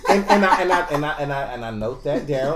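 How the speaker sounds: stammering through laughter; loud chuckling stammer; laughing. Real, not acted. Laughing while joking.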